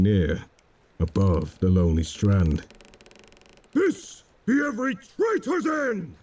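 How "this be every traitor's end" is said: high, ringing triumphant cry; cried out. A narrator's reading. Drake crying out over the traitor's body, high ringing cry. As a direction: proclaim it over the beheaded man. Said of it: "Drake cried".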